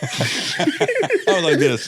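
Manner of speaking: slow words through real laughter; laughing. Real, not acted. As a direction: laugh the line out. Laughing at the joke.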